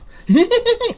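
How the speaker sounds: high unhinged villain cackle; insane laugh. Acted. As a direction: cackle like a madman. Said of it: "No, that's not evil. That's insane".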